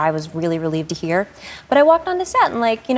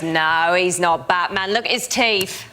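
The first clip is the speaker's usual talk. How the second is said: imitated Cockney dismissal; Cockney boy; slower than usual. Second boy correcting his mate, imitated Cockney accent. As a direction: scoff like a London lad, in Cockney. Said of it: "I think it's a Cockney accent".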